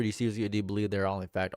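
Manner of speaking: ordinary delivery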